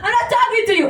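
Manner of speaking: high-pitched exasperated outburst; acted angry shriek; angry yelling. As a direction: snap at the gadget in mock fury. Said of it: "to really get angry".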